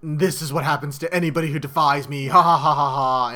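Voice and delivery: gloat, then let out a hammed-up "ha ha ha"; gloating villain. Villain gloats while electrocuting Suicune.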